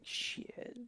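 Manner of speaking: whispered, voiceless curse; acting out a quiet, deflated curse; retold defeated reaction. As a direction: let it out under your breath. Retold reaction of embarrassed classmate.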